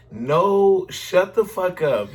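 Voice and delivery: high, loud shocked reaction; no way, shut up; disbelief. Real, not acted. Cohost blurting disbelief at the gossip.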